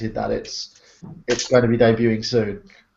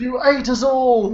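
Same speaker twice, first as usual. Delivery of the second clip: higher, slowed, accusing wail; outraged tin voice; 12 semitones higher, slower than usual. Pretend. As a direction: wail it like a betrayed food tin. Playing the eaten tin.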